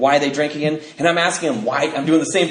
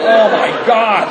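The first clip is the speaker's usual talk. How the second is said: slow, louder oh my God through laughter; laughing disbelief; 5 semitones higher, 6 dB louder, much slower than usual. Real, not acted. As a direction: gasp it out laughing. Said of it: "I'm like".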